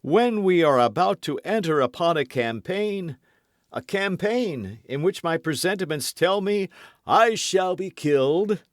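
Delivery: mock melancholy. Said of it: "in the most melancholy tone he could assume".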